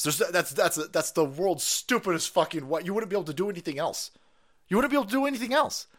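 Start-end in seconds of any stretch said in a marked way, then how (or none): 4.7-5.9 pitched way up, fast and incredulous; a squeaky sped-up echo of the line before; exasperated high repeat. Acted. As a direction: fire it back higher and faster, disbelieving.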